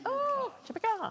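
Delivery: very high mock Jamaican accent on Oh, Jamaica. Host trying the Jamaican accent joke, squeaky and high. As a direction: pitch Oh, Jamaica way up in a Jamaican lilt. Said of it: "in a Jamaican accent".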